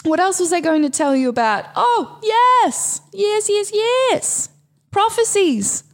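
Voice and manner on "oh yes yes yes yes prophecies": high-pitched burst of delighted yeses; excited remembering. Real, not acted. Host suddenly remembers the full moon prophecies, excited. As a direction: exclaim it high as it comes back to you.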